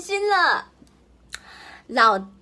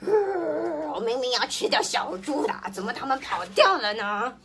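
fast, puzzled grumble; mild wolf annoyance; lightly voiced angry wolf. A narrator's reading. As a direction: huff that the pigs got away. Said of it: "大灰狼生气极了".

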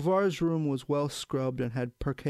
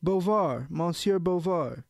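raised, louder call for attention; 4 semitones higher, 4 dB louder, slower than usual. A narrator's reading. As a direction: hail someone across a courtyard. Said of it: "a voice called out".

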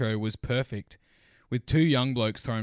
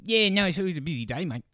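imitating driver; 7 semitones higher, 4 dB louder than usual. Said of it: "he's like".

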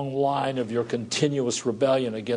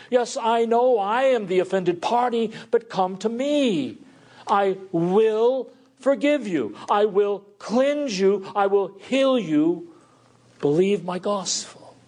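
voice of the Lord pleading; 6 semitones higher than usual. Acted. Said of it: "And he says".

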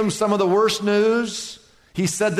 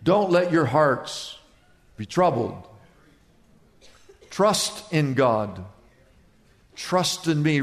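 soothing Jesus; 5 semitones lower than usual. Retold or imitated. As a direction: gently settle frightened friends.

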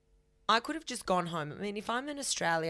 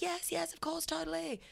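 much higher, softer eager reply; eager high yes; 11 semitones higher, 4 dB quieter than usual. Retold or imitated. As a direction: gush a breathless eager yes. Eager agreement quoted.

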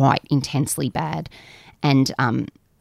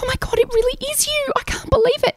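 very high-pitched amazement; imitated disbelieving fan. Deli worker to the podcast host, imitated awe. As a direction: squeal it like a starstruck admirer. Fan's amazed reaction retold.

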